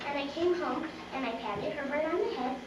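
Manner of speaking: ordinary delivery, nothing marked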